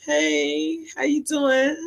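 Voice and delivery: high but soft, sweet-fake hello; fake polite greeting. Washing the car, answering the neighbor softly and high. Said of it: "I be like".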